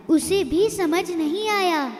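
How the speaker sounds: ordinary delivery, nothing marked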